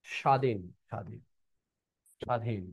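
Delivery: স্বাধীন as "shaa-dhin", with no b; silent bo-phola. Shown as a demonstration. Teacher modelling the rule for pupils, স্বাধীন as "shaa-dhin". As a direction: say স্বাধীন softly, opening on a plain "sh". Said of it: "শুরুতে বফলা থাকলে উচ্চারণ হবে না".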